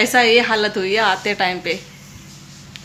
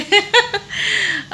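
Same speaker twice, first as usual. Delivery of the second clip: a bright burst of real laughter, far higher; laughing at her own clumsy fall; 10 semitones higher than usual. Real, not acted. Telling viewers about her fall, she bursts out laughing.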